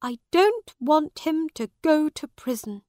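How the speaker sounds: worried. A narrator's reading. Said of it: "She was worried".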